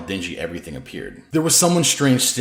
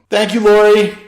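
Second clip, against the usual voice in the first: called thanks; 13 semitones higher, 12 dB louder than usual. A narrator's reading. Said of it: "Danny's tone softened".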